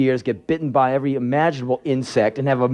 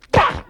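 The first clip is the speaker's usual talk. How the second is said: short harsh "gah" burst; imitated attacking yell; attack noise; 3 dB louder than usual. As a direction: lunge with a sudden cry.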